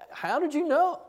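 high, startled "how did you know"; surprised question. Retold or imitated. Young worker amazed his boss guessed, surprised. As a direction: ask it in disbelief. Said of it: "And I, and I said".

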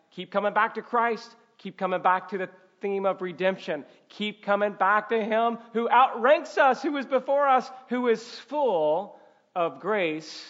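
insistent, building exhortation; fervent preaching. Real, not acted. Preacher urging church back to Christ, fervent and building.

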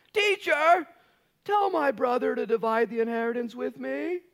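high, loud pleading shout; whiny demand. Retold or imitated. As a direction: call out to the teacher, high and loud. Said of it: "this is what this guy says".

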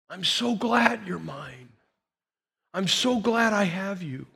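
slow, tender reassurance; intimate tenderness. Acted. The Lord leaning in to his child, tenderly. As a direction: dwell lovingly on each phrase. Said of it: "lean into you and say".

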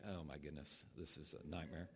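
low, quiet inner groan of dread; muttered dread. Retold or imitated. Preacher voicing his inner panic at the busker, in a quiet mutter. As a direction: mutter it to yourself like a private nightmare.

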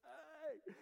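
high-pitched acted "hey"; imitated startled onlooker; mock calling out. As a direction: call up to someone through a hole. Startled crowd looking up into hole.

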